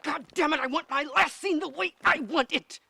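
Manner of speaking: very high, loud yell; furious tantrum. Acted. Director demanding the last scene their own way, furious. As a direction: scream the demand in full fury.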